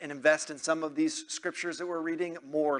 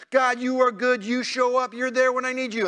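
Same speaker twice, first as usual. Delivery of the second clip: higher, louder, relieved praise; joyful praise; 7 semitones higher, 4 dB louder than usual. Acted. Preacher voices David praising God, joyful. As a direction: burst out thanking God for showing up. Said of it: "And then God shows up".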